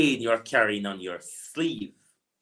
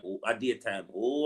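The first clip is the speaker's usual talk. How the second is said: ordinary delivery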